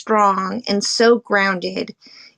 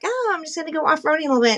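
carefree; 8 semitones higher, much faster than usual. Retold or imitated. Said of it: "Sometimes we're like".

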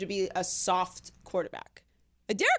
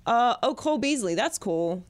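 ordinary talk, nothing marked

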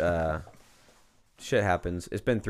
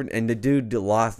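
ordinary talk, nothing marked

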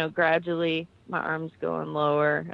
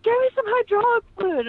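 high, loud, desperate order; stuck soldier shouting up at the crew chief; urgent demand; 5 dB louder than usual. Retold or imitated. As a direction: bark it like you're trapped. Trapped speaker calling for help.

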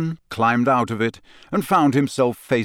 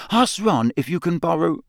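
higher earnest schoolgirl, worried; a clever girl reasoning it out; Hermione voice; 8 semitones higher than usual. A narrator's reading.

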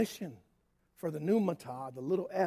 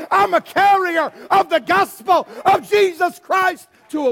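loud, high preaching yell; sermon yelling; 7 semitones higher, 15 dB louder, slower than usual. Acted. Preacher shouting the healed man's story, loud preaching yell. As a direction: yell it over the crowd, not angry. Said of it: "I'm not angry when I yell at you".

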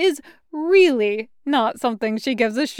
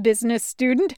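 usual speaking voice

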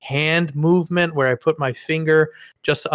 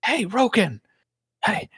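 urging whisper retold; 6 semitones higher than usual. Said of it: "leaned over to me and said".

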